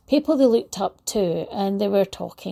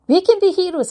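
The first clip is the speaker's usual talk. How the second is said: high and louder, a burst of delight; excited kids; 9 semitones higher, 6 dB louder, faster than usual. Retold or imitated. Retelling the schoolkids realising they can be heroes, delighted. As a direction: sing it out like children catching the idea.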